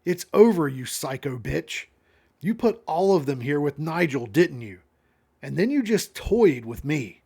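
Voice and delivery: a contained scream of accusation; narrated scream. A narrator's reading. Monica accusing someone out the truck window, mild scream. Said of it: "Monica screams out the window".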